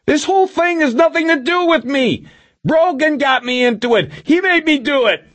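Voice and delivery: high, panicked screamed excuses; desperate high-pitched protest; Milosevic screaming. A narrator's reading. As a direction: screech the blame onto someone else. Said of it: "Milosevic screamed".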